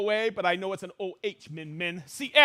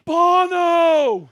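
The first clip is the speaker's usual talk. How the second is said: excited shout; 8 semitones higher, 13 dB louder than usual. Real, not acted. Joke on U two name.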